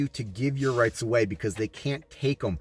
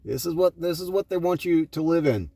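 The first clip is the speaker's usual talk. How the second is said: raised, fast warning; indignant preaching; 3 semitones higher than usual. Acted. Preacher showing dystopia picture, indignant fast warning. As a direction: warn them hotly what they're being sold.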